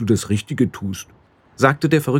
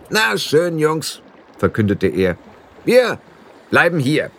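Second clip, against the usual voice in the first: high, eager little blue man; squeaky fairy barking an order; high pixie voice; 6 semitones higher than usual. A narrator's reading. Pixie chief urging the boy to run, high eager voice. Rob Anybody announcing.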